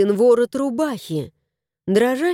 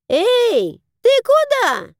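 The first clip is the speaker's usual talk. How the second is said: calling out; 13 semitones higher, 4 dB louder, slower than usual. Acted. Crow calls after him.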